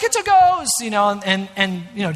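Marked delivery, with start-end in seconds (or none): 0.0-0.7 high, loud spooked yelp; playful imitation of panic; mock frightened cry. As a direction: squeal it like a scared disciple. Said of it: "They're gonna be like".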